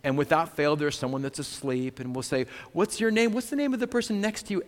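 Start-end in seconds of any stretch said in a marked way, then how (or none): 2.7-4.6 high, bright call to wake a dozing resident; sing-song wake-up call to a sleepy resident. Retold or imitated. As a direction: pitch it up and fast to get attention.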